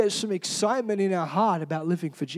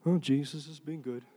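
low, very soft, joyless answer; mock half-hearted testimony; unenthusiastic churchgoer; 8 semitones lower, 13 dB quieter than usual. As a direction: praise with no life in it. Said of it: "we're like this".

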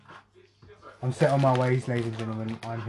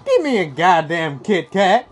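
slow, drawn-out cartoon sidekick demand; Donkey; 4 dB louder, slower than usual. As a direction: stretch it out as a comic animal sidekick. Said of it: "I just did Donkey there".

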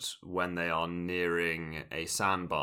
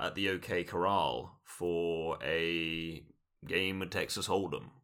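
ordinary talk

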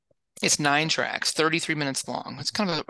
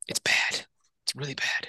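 whispered; 3 dB quieter than usual. Real, not acted. Verdict on the bad special.

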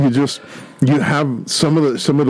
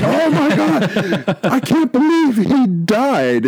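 high, slow, hammed-up shock; mock aghast gasp; 11 semitones higher, slower than usual. A background character stares at the body, mock aghast and slow. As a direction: gasp it out like a stunned comic character. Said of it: "It's like".

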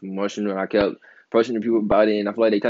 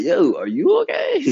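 mock concern; 11 semitones higher, 4 dB louder, slower than usual.

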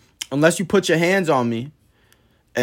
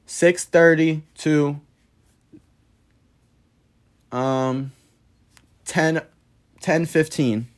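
slow and halting, thinking it up; slow, searching delivery; much slower than usual. Shown as a demonstration. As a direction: make up the numbers slowly as you go. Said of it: "That's an example number".

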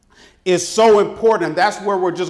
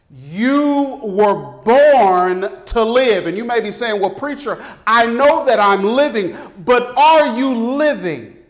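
raised, ringing preacher's challenge; booming motivational speech; 3 semitones higher, 3 dB louder than usual. Acted. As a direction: push it out like a revival preacher. Preacher builds to full force.

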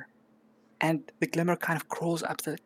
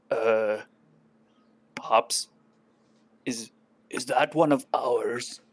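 goblin guard; 4 semitones lower, slower than usual. Acted. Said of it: "you hear him say".

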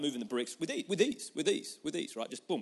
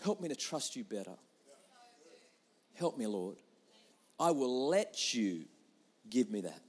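soft humble prayer. Shown as a demonstration. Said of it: "No, no".

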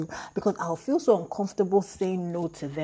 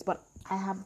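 normal talking voice

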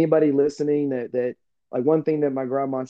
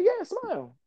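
imitated grandma; slower than usual. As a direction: imitate grandma in a high voice, confirming. Grandma confirming her advice, retold.

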